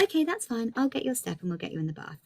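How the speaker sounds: put-on sing-song okay to a child; cheerful mum. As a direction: mum agreeing with toddler. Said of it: "And then I was like".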